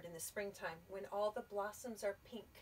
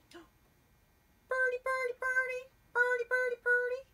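much higher, louder chirping bird; high, loud cardinal call; birdcall chirp; 13 semitones higher, 10 dB louder than usual. Acted. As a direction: sing out like a cardinal. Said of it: "said the cardinal".

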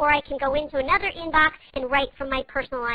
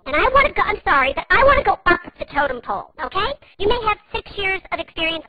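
louder and higher, insisting; demanding; 5 semitones higher, 5 dB louder than usual. Acted. Caller demands the agent's supervisor, louder and higher. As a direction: push hard to get escalated.

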